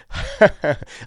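a brief knowing chuckle; short laugh. A narrator's reading. Pinner laughs at the clerk's answer, a sly chuckle. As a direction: laugh off his doubt, sly. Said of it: "sporting flutter".

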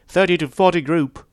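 high, loud, piercing call; piercing yap. A narrator's reading. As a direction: yap it out like a drill instructor. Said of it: "yapped a piercing female voice".

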